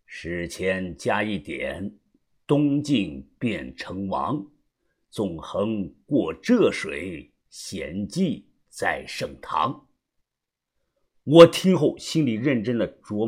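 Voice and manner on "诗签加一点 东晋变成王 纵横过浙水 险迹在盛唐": slow, low, measured recitation; low voice, pausing between verse lines; reciting verse. A narrator's reading. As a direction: intone an old riddle poem slowly and softly. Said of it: "他想了想念道".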